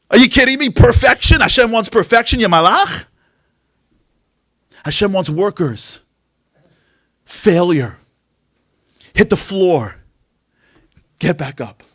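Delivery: high, loud sermon build-up; fiery preaching. Real, not acted. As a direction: preach it to the back row. Preaching at full force.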